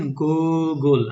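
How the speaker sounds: Google drawn out as two separate parts, "Goo... gle"; split into syllables. Shown as a demonstration. Teacher mimicking the student's two-part Google, syllables stretched apart. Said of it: "আমরা তো ওইভাবে বলছি না".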